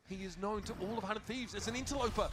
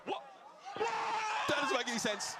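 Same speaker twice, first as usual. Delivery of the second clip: disbelieving shout; 5 semitones higher, slower than usual. Real, not acted. Caster in disbelief.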